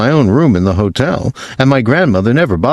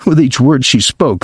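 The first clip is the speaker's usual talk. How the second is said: plain reading voice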